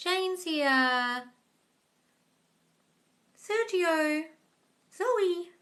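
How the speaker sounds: normal talking voice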